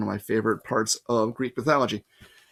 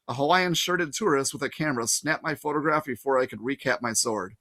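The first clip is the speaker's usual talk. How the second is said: usual speaking voice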